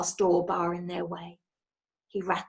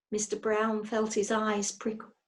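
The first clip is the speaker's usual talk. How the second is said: plain reading voice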